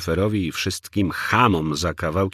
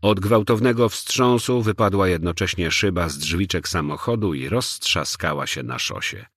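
ordinary delivery, nothing marked

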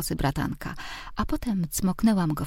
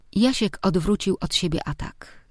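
ordinary delivery, nothing marked

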